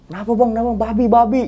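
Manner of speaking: high-pitched excited back-and-forth; mock alarm. Woken campers ask and answer about boar, alarmed.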